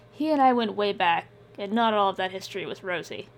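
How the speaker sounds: ordinary talk, nothing marked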